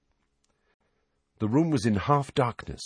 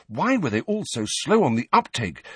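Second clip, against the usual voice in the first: ordinary delivery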